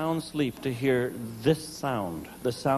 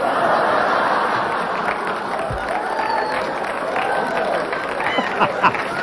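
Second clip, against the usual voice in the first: silent high wheeze of laughter; high wheezing laugh; 4 dB louder than usual. Real, not acted. Comic cracking up at his own joke, a high wheeze. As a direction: break up laughing high and breathy.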